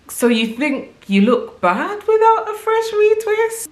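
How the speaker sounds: high-pitched disbelieving question; incredulous. Retold or imitated. Reacting to people's retwist comments, incredulous.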